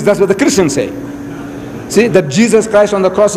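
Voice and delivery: normal talking voice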